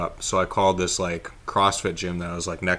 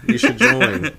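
creepy cult recruiter; 8 semitones higher, 7 dB louder, slower than usual. Pretend. Luring friend into Illuminati.

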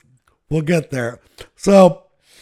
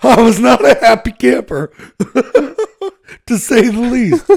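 laughing; 6 semitones higher, 5 dB louder than usual. Real, not acted. Laughing after the punchline.

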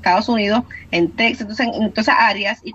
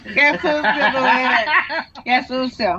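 laughing while teasing; 3 semitones higher, slower than usual. Real, not acted. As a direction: tease through a laugh. Teasing a rival's joke on air.

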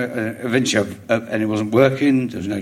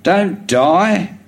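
clipped order, higher and louder; commanding order; 7 semitones higher, 4 dB louder than usual. Retold or imitated. Wife shouting an order at the comedian, mock command. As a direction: bark it like a command. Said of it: "like an order".

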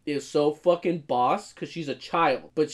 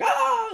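mock battle cry. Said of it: "she's like".

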